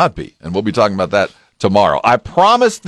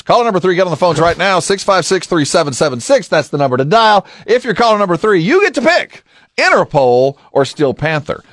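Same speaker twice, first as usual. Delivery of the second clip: radio contest promo; 7 semitones higher than usual. Acted. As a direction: pump up the listeners to call. Radio contest call to action.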